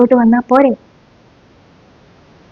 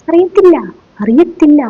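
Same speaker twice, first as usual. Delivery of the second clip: mocking the student; 6 semitones higher than usual. Teacher mimics clueless student.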